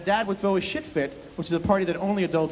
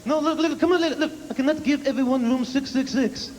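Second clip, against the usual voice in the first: high, exasperated ranting dad; a hammed-up neurotic New York dad; imitating neurotic dad; 8 semitones higher than usual. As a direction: play the frantic father yelling at the clerk. Dad ranting at front desk.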